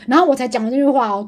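ordinary delivery

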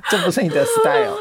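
teasing through a high laugh. Real, not acted. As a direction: tease her while laughing. Teasing friend while laughing.

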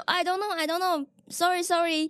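mock-panicked tourist apology; nervous apology. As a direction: stammer it out in a panic. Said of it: "我那时候太紧张了".

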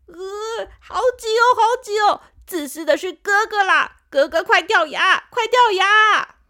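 high loud bratty voice, slowing at the end; younger tooth. Acted. Younger tooth blames big brothers, loud bratty demand. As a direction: push back and demand they fall out. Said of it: "大佑弟弟说".

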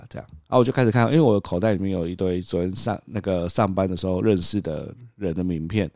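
ordinary talk, nothing marked

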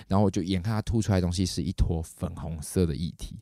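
ordinary delivery

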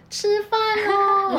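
high, slow, drawn-out call; calling kids to dinner. Retold or imitated. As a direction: call the kids home from far off. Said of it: "大声喊".

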